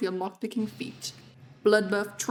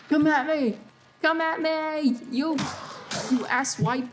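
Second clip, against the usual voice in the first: taunting shout; 6 semitones higher, slower than usual. Pretend. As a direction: challenge the enemy, shouting. Frustrated gamer taunting enemies.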